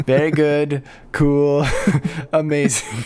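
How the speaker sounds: hammed-up Japanese accent read; mock Japanese accent. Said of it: "a Japanese accent voice".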